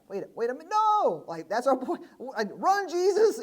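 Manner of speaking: high, loud comic yelling; high, loud hammed-up panic shout; mock shout. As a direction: yell at a friend to run away. Disciples shouting to Jesus.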